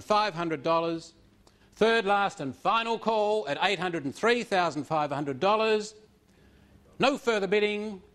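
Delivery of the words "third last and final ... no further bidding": auctioneer calling the final bids; raised formal auction calls, three times; auction bid spotter. Real, not acted. Auctioneer closes the sale.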